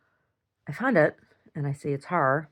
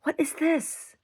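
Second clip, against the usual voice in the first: mock bafflement; 12 semitones higher, slower than usual.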